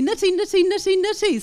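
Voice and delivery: high quick flap-T drill; syllable drill. Shown as a demonstration. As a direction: bounce "nitty, nitty" lightly and high. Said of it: "tongue will come down for the I H vowel".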